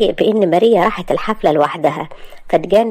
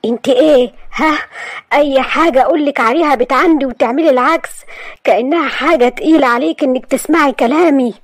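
angry; 6 semitones higher than usual. Acted. Asad cutting Maria off, angry. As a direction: cut her off in anger. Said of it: "قطع أسد بغضب".

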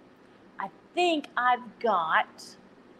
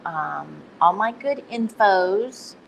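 ordinary talk

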